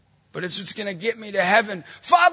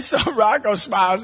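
usual speaking voice

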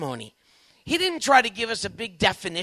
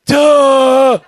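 loud, higher sarcastic duh; mock duh; 6 semitones higher, 15 dB louder than usual. Husband imagining a sarcastic reply to his wife, loud mocking. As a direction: blurt the obvious, loud and mocking. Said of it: "every ounce of my being not to go".

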